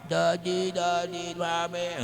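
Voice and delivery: put-on soft, sing-song baby voice calling daddy and mommy; baby talk. Preacher imitating a baby for the congregation, soft baby talk. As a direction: coo it like a toddler. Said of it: "pag nagsalita yan, papaano?".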